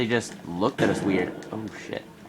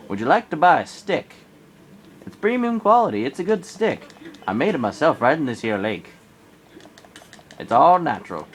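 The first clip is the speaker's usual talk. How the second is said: high, loud hammed-up salesman; mock pushy peddler; salesman pitch; 4 semitones higher, 3 dB louder than usual. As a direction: sell the stick like a huckster. Repeated stick sales pitch.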